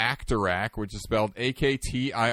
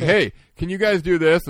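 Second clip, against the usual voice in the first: excited call; 7 semitones higher, 7 dB louder than usual. Retold or imitated. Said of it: "And then he's like".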